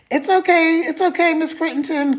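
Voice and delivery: high-pitched placating phone voice; soothing staffer. Retold or imitated. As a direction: imitate someone gently talking down a panicked parent. Voices camp staffer soothing her.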